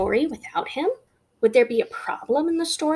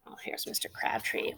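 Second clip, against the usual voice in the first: soft unvoiced whisper; 14 dB quieter than usual. Real, not acted. Teacher whispering as she turns to a new page. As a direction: murmur it under your breath. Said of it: "whispering while paging book".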